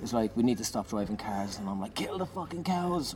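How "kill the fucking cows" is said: mock shouting. Comedian undercuts the straight man in song, high mock shout. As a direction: stretch it like a held-back mad yell. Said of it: "I'm shouting all these different things".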